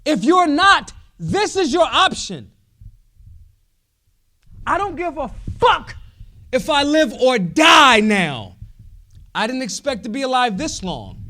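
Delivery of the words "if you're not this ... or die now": full-throated, much louder and higher; real shout. Real, not acted. Rallying the libertarian crowd, passionate shouting. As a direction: roar it at the room.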